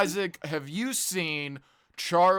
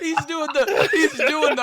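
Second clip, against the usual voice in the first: high, loud and laughing; cracking up; 10 semitones higher, 7 dB louder than usual. Real, not acted. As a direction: talk through real laughter. Laughing at the described video.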